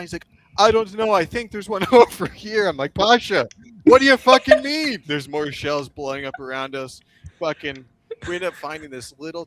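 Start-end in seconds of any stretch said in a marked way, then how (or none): 0.6-2.7 higher and louder, laughing through it as Pasha; imitating Pasha's nervous laughing answer; laughing reply. Retold to a podcast host, Pasha laughing while shells land. 2.9-5.0 much higher and louder, yelling over the shells; exasperated yell. Retold or imitated. Retold to a podcast host, yelling at Pasha under fire.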